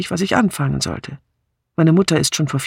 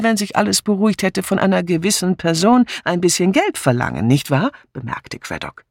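ordinary delivery

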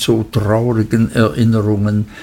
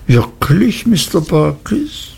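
higher, rising disbelief on "Wirklich"; surprised question; 7 semitones higher than usual. A narrator's reading. Passenger queries what Barkis has just claimed, surprised. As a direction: query it with a lifted, wondering voice.

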